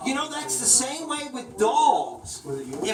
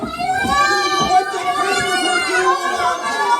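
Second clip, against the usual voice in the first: high playful repeat; 6 dB louder, faster than usual. Shown as a demonstration.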